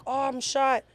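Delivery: mock cry of pain, pitched high; imitated screaming. Shot girl screaming as people step on her, imitated. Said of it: "she's screaming".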